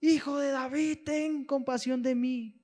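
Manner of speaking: high, slow, loud plea; desperate cry. Acted. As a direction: call out to be heard over the crowd. Bartimeo cries out again.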